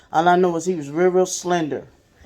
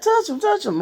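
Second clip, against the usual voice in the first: much higher, insistent coaxing voice; urging older sister. Retold or imitated. Foster sister urging the boy to touch his dead dad, high and insistent. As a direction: coax him toward the casket, repeating it.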